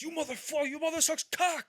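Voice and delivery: intense, high, loud cursing rant; imitated enraged outburst at high pitch; De Niro. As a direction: explode into a furious mock yell. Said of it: "how intense De Niro was gonna be".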